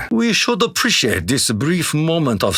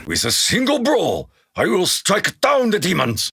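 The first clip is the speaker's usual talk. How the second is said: raised pitch, fierce and determined; fierce battle vow; 7 semitones higher than usual. Acted. Samurai warrior vows to strike down demons, fierce raised vow. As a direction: boast of the killing blow with fire.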